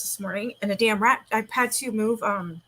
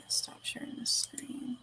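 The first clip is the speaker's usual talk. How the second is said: breathy whispered aside; 4 semitones higher, 12 dB quieter, slower than usual. Real, not acted. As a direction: whisper it under your breath. Aside while streaming.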